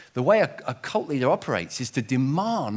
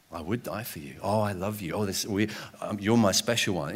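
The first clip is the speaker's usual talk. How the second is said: cult leader voice; 8 semitones lower, 4 dB quieter than usual. Retold or imitated. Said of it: "He used to say".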